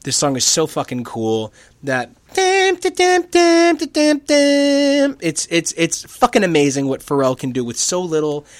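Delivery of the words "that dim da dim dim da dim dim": high, slow popping mouth beat; beatbox mouth noises. Retold or imitated. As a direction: pop the beat out with your mouth. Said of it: "noises like he's popping ping pong balls".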